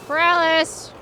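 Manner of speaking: loud call of the name. Real, not acted. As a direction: shout for him. Calling out for neighbor.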